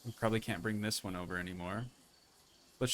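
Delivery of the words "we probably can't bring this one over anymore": normal talking voice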